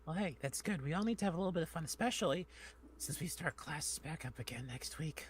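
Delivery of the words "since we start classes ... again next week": whisper. Real, not acted. Dreading classes.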